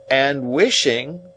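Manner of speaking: slowly piecing a message together; effortful remembering. A narrator's reading. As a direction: draw it out while recalling. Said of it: "like another effort of remembrance".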